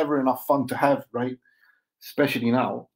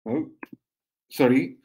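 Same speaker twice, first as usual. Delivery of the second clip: ordinary delivery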